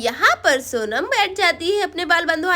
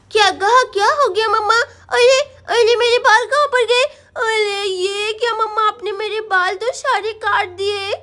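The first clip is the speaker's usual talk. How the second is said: put-on higher lisping child voice, upset; little girl Sonam, dismayed; 4 semitones higher, 3 dB louder than usual. Girl discovering mama cut her hair, dismayed. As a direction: wail in childish shock at the loss.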